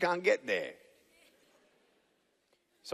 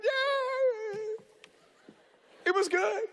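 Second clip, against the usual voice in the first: forced cheer; much slower than usual. Retold or imitated. Hiding the failure from mom.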